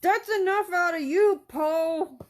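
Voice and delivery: high, loud hammed-up scold; mock scolding. Owner playfully telling Poe off, high loud mock scold. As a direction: tell the cat off in a silly high voice.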